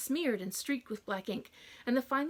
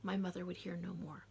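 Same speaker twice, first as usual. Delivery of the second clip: ordinary delivery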